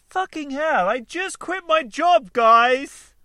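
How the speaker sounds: high, stretched-out triumphant announcement; acted elation. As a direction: proclaim it like thrilling news to everyone. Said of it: "'cause it could be like".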